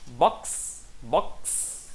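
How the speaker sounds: "Box" with only a drawn-out final s; failed plural attempt. Shown as a demonstration. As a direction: try "Box" as a plural in one syllable, hissing the ending. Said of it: "Não dá, né?".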